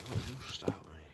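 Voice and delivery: hushed mock threat to the dog; whispered warning.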